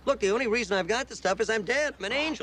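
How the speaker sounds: high-pitched, insistent arguing; worked-up, pleading justification; exasperated defence. Acted. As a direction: spell it out in exasperation. Defends himself in the quarrel.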